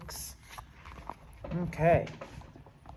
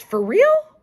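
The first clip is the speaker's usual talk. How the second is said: excited; 12 dB louder than usual. Acted. Judy excited on phone.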